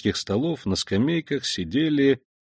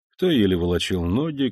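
ordinary delivery

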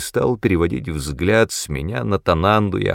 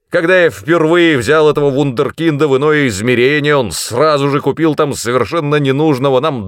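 loud, high, indignant rant; outraged outburst, pitched up; explosive tirade; 7 semitones higher, 5 dB louder than usual. A narrator's reading. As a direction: pour out exasperated accusations. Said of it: "снова взорвался мой наставник".

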